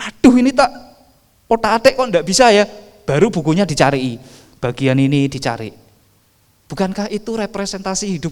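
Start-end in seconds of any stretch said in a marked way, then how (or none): 0.1-2.6 louder, higher mock exasperation; frustrated fiddling. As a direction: act out a frustrated owner who can't get the gadget to work. Frustrated owner fiddling with gadget. (kesal pura-pura, lebih keras dan tinggi)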